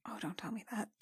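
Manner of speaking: pleading under your breath; horrified whisper. Real, not acted. Player pleading quietly as the teen gushes. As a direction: whisper in dread. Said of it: "horrified whispered aside".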